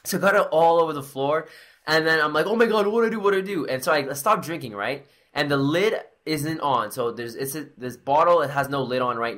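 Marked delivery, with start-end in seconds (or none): 2.5-3.7 high, flustered repeated question; acted panic. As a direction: replay yourself at a loss, pitch up. Replays own panic at the leak.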